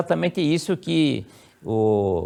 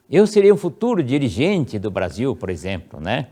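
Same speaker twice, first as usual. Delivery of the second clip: first-person affirmation; 3 semitones higher, 4 dB louder than usual. Shown as a demonstration. Child reciting the prayer to self, high and solemn. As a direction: proclaim it to yourself. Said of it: "você vai ler assim".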